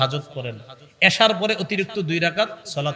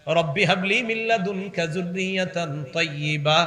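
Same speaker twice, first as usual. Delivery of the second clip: slow, melodic Arabic recitation; measured, drawn-out chant; Quran recitation; slower than usual. Acted. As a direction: chant the prayer with long held vowels.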